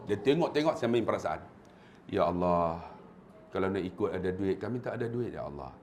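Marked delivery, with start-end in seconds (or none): 2.1-5.7 low, soft supplication; pleading prayer. Retold or imitated. Guide praying with pilgrims before the Kaabah, low soft plea. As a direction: plead quietly to God, sinking lower.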